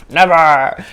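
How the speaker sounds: hammed-up refusal to let go; mock clingy. Teacher jokes about never letting her partner leave, mock clingy.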